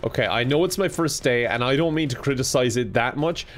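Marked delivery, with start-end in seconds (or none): none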